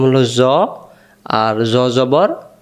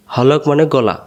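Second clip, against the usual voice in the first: normal talking voice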